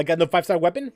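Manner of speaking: ordinary talk, nothing marked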